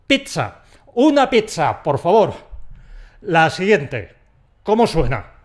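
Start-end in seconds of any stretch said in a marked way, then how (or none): 0.1-2.3 pizza with a crisp ts, higher pitch; ordering pizza. Shown as a demonstration. Customer ordering at a counter, higher and bright. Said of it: "La pronunciación más adecuada".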